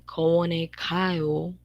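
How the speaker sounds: kowone kayo said very slowly, syllable by syllable; drawn-out Korean example; slow Korean model. Shown as a demonstration. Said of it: "Yo voy al parque".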